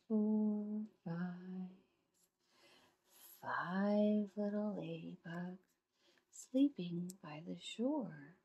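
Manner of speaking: gently paced storybook reading with animal noises; reading to children. Acted. Parent reading a bedtime counting story to a child, playful storytelling. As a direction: read it like a bedtime story for a little one.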